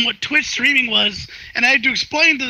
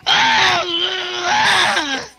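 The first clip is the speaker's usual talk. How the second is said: high, drawn-out cry of "ah"; imitated Junkrat yell; 6 semitones higher than usual. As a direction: wail it out like a game character. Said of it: "making the noises, you know, like".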